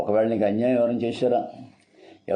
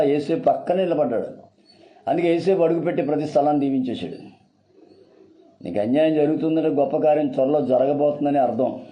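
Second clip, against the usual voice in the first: raised, sustained preaching pitch; impassioned preaching; 4 semitones higher than usual. Real, not acted. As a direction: proclaim it to the congregation, pitch held up. (ఆవేశపూరిత ప్రసంగం)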